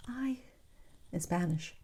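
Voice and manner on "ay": soft, higher, breathy mother's sigh; a hint of a doting mother; adoring mom. Imitating her mom's adoring sigh. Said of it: "an adoring voice".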